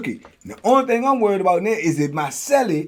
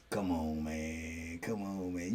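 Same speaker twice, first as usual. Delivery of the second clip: low coaxing commander; 10 semitones lower, 16 dB quieter, slower than usual. Retold or imitated. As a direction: lower and softer, a coaxing watch commander.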